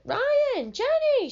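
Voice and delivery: high, loud shriek of names; excited scream. A narrator's reading. Little sister screaming for Ryan and Jenny at the door. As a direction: shriek the names through the house. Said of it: "she screams".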